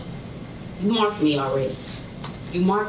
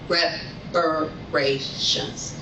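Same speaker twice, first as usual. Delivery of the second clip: slow drawn-out; much slower than usual. Real, not acted. Repeated demand, drawn out.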